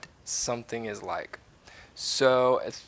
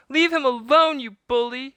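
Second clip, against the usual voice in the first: very high, loud angry cry; little girl yelling at a pirate to free her brother; 9 dB louder than usual. A narrator's reading. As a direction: scream at the bully to let go. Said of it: "she cried".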